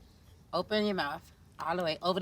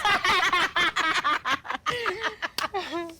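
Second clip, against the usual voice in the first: triumphant laughter; 9 semitones higher than usual. Real, not acted. After the shot.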